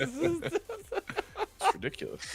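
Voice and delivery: high and slow through laughter; laughing. Real, not acted. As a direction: giggle the words out. Laughs at himself.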